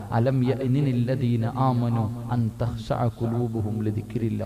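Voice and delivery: slow, low recitation; measured, low-pitched Arabic recital; Quran recitation. Retold or imitated. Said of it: "ഉമ്മ ഖുർആൻ ഓതുകയാ".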